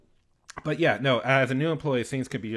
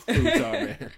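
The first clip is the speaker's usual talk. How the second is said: laughing retort; 13 semitones higher, 6 dB louder, slower than usual. Pretend. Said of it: "I know what my f".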